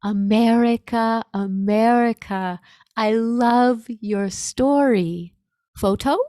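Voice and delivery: loud, slow, drawn-out call to a foreigner; loud retold soldier's appeal; imitating the soldier. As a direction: call out each word slowly, as an excited fan with few English words. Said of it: "I can hear the words that he's saying".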